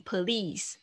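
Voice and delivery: "please" broken into "puh-lease"; extra vowel. Shown as a demonstration. Teacher shows students a wrong "please", split as "puh-lease". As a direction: slip a vowel between P and L, like "police". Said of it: "不是这样念".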